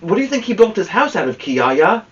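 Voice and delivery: plain reading voice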